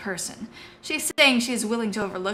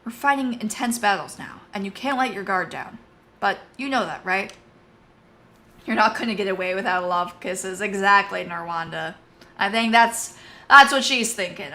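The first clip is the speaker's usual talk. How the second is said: ordinary talk, nothing marked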